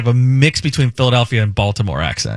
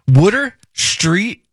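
"Wooder" for water, drawn out in Philly style; Philly accent; 6 semitones higher, much slower than usual. Shown as a demonstration. Host showing co-hosts how it's done, Philly accent. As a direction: show off slow Philadelphia vowels on "Wooder, Street". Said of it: "that's how you do it".

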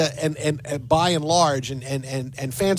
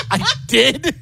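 surprised laugh; 6 semitones higher, 3 dB louder than usual. Real, not acted. Realizing his own mistake.

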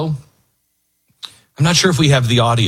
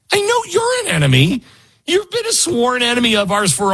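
mock accuser; 11 semitones higher than usual. Mock Trump to Canada.

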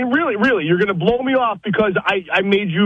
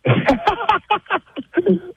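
high, uneasy laugh down the phone; real nervous laughter, pitched high; 8 semitones higher than usual. Real, not acted. Caller brought on air to face his date, nervous laugh. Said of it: "He's got the nervous laugh".

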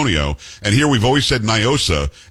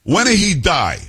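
higher-pitched mock Italian woman; thick Italian accent; 7 semitones higher than usual. Bold woman confronting the anchor about his grandfather, thick Italian accent. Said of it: "a thick, um, Italian accent".

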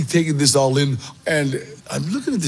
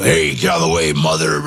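imitating a growling con much lower in the voice; growling convict; 11 semitones lower than usual. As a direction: bark it out like a movie prisoner. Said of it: "And just really growling".

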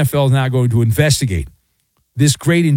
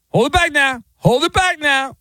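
high mock cry of hold it back now; mock wrestling handler; 11 semitones higher than usual. Staffers restraining McBride, hammed-up pleading. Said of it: "like professional wrestling".

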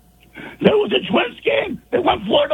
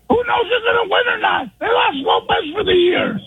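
strained hoarse shouting; hoarse yell. Real, not acted. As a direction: yell through a ruined voice. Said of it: "That is next level".

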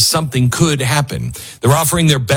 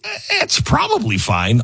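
much higher, breezy and dismissive; careless shrugging owner; 10 semitones higher than usual. Pretend. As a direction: brush every problem off lightly. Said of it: "Don't go with".

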